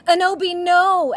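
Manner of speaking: very high, loud anguished scream; hammed-up despairing scream. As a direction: shriek no at the top of your lungs. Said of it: "screamed Draco".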